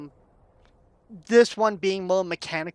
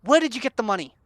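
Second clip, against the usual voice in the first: angry demand; 4 semitones higher, 3 dB louder, faster than usual. Acted. Barkeep demands answer from Fred.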